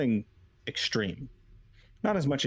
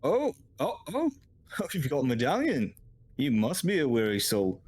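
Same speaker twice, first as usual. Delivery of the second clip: high-pitched guard voice; high, swooping character voice; impressed guard; 5 semitones higher than usual. Acted. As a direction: greet the stranger with sudden recognition. Guard reacts to the medallion.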